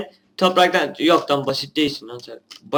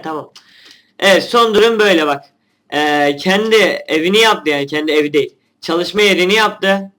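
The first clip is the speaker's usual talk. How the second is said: ordinary delivery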